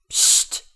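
loud, voiceless "psst"; sharp shushing. A narrator's reading. Audience member behind shushes the talking pair, sharp hiss. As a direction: shush the people in front of you. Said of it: "machte eine Stimme von hinten".

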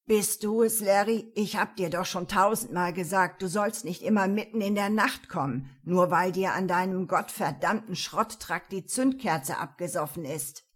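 loud, grumpy voice just woken up; sleepy grumbling. Acted. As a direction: grumble like you were dragged out of bed. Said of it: "eine völlig verschlafene Stimme maulte". (verschlafen und maulend)

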